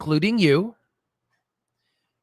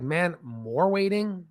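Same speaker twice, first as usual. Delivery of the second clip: drawn-out, slightly softer grumble; weary patient; 5 dB quieter, much slower than usual. Acted. Patient left waiting in the exam room, weary complaint. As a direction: drag out the complaint in mild disbelief. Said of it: "You're like".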